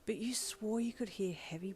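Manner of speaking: breathy narration whisper; tense whispered storytelling. A narrator's reading. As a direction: hush it like a secret.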